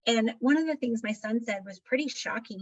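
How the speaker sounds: ordinary talk, nothing marked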